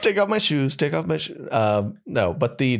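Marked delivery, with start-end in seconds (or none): 0.0-1.5 clueless traveler. Retold or imitated.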